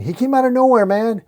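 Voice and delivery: high, agitated report; panicked explaining. A narrator's reading. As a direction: blurt it out like a shaken henchman. Frightened henchman explains the attack.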